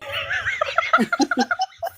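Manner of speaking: a long, high squeal of laughter; high shrieking laugh. Real, not acted. Host cracking up at the cohost's joke, high shrieking laugh. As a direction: burst out in shrieking giggles. Reacts to "Seven inches.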